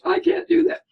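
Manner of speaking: high, loud whine of a parent who won't do it; mock whiny protest. A parent mocking their own excuse with a high whine. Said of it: "some of you think".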